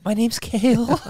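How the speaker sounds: high, loud quiver breaking into laughter; hammed-up trembling introduction; mock quivering voice. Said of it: "the quiver in their voice".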